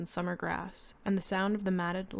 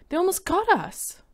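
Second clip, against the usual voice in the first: high, loud tearful panic; crying in fear; 12 semitones higher, 10 dB louder than usual. A narrator's reading. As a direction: sob it out terrified. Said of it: "she was crying".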